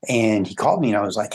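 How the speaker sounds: ordinary talk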